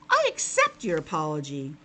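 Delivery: a gracious frog accepting. Acted. The frog forgives Albert.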